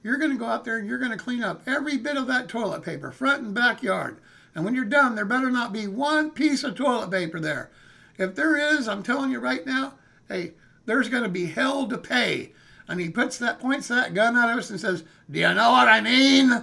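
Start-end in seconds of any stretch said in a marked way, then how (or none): 0.0-12.5 louder, higher menacing warning; angry homeowner threatening teenagers; stern threat. Retold or imitated. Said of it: "he said". 15.3-16.5 very loud threatening question; menacing bellow. Retold or imitated. Gunman pointing gun threatening.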